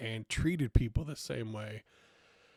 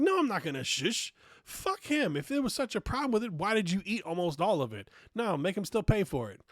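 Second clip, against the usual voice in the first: high, loud, heated retort; refusing to be hushed over the uncle's bill, high and loud; heated refusal; 8 semitones higher, 6 dB louder than usual. Retold or imitated. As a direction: push back hard, getting louder.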